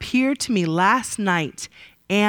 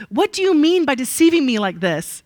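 demanding; 5 semitones higher, 4 dB louder than usual. A narrator's reading. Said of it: "Laban demanded".

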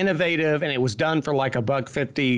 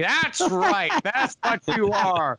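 put-on high, slow, grand old studio big shot; Sam Raimi's old-timey director voice; 6 semitones higher, slower than usual. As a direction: lord it over them like a pompous old Hollywood director. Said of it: "old-timey Hollywood director voice".

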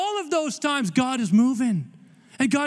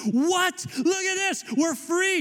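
mock amazed prisoners; 7 semitones higher, slower than usual.

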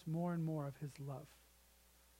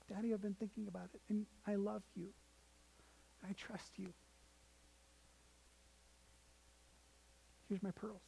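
put-on soft, hesitant little girl giving in; hushed imitation of a child, long pauses between phrases; tearful surrender; 9 dB quieter than usual. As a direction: say it very quietly, as if through tears. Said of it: "tears streaming from her face".

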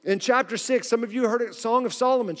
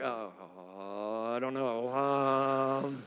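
much lower, soft reluctant groan; mock reluctant groan; 8 semitones lower, 9 dB quieter, much slower than usual. Pastor imitating a congregant avoiding a date, low reluctant groan. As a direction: drag it out like a spouse dodging date night.

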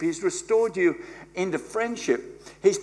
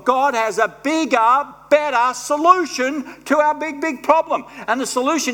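louder, higher, rising preacher's build; full-force preaching; 7 semitones higher, 7 dB louder than usual. Acted. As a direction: preach it out with fire.

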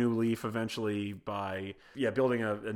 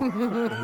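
bursts out laughing high; a quick real laugh at his own joke; giggling burst; 11 semitones higher, 5 dB louder than usual. Real, not acted.

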